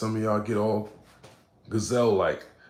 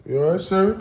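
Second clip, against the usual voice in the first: higher, louder "You all right, sir?"; concerned caller; 8 semitones higher, 7 dB louder than usual. Retold or imitated. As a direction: check on him, rising. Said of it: "It was like".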